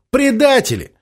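high scornful cry; angry outburst. Acted. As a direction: spit out the word in contempt. Angry officer insult.

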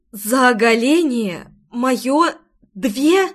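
slow, drawn-out astonished question; incredulous. A narrator's reading. Girl questioning her payment for undressing, incredulous. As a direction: stretch the words in disbelief.

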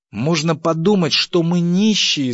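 high, loud indignant cry; wife shouting at a miserly husband; angry shout. Acted. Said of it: "закричала жена". (возмущённый крик жены)

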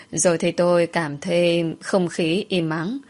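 plain reading voice

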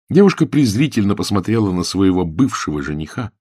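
plain reading voice

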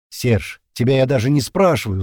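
usual speaking voice

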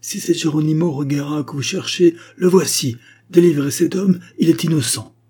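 high, loud plea over a crowd; urgent self-surrender. A narrator's reading. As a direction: call out boldly to stop the mob. Geronimo gives himself up to mob.

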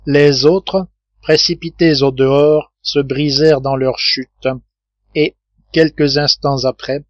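ordinary delivery

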